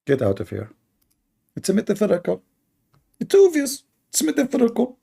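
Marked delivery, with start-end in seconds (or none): 1.6-5.0 high, sneering sing-song; mock them by squeaking the excuse up high; scoffing high parody.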